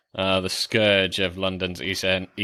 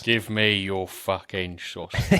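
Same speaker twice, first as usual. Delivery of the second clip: slow put-on London hardman; mock Cockney gangster. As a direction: growl it like a Kray twin. Gangster impression joke.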